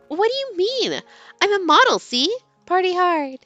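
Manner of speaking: put-on bright, high-pitched teen girl; high girly voice. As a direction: play her as an eager, bubbly girl. Heroine voiced in read story.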